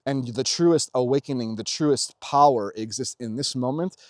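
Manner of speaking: ordinary talk, nothing marked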